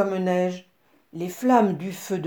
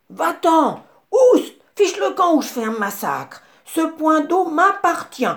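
babouin hurlant, très aigu et fort; chasse-le en criant de rage; voix furieuse; 9 semitones higher, 6 dB louder than usual. A narrator's reading. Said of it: "elle entendit une voix furieuse lui crier". (cri furieux du babouin qui défend son point d'eau)

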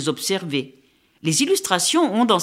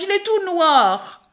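much higher, slow, stress on tout; shocked shriek; 12 semitones higher, slower than usual. A narrator's reading. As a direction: stretch out tout in horror. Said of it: "hurla la mésange".

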